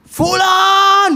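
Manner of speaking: very high, loud, long-held shout; mock parent yelling a name; loud calling from afar. Shown as a demonstration. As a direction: holler for your child across the house. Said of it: "jangan memanggil anak dari jauh dengan suara".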